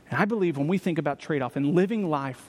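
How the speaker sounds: usual speaking voice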